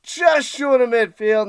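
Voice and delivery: loud, high-pitched TV play-by-play; hyped announcer call. Acted. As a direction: sell the kickoff like a big-game broadcaster. Hyped broadcast-style kickoff call.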